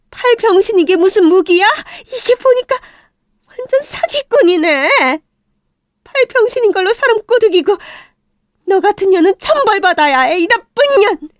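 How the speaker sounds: 크게 악쓰며 퍼붓는 욕설; 높고 크게 분노를 쏟아내듯; 격분한 고함. Acted. Mother berates the girl. (아들을 넘본 소녀에게 퍼붓는 엄마, 격분한 고함)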